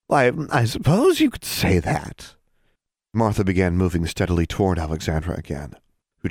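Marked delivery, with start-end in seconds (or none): 0.1-2.3 high sly witch voice; a scheming hag playing coy.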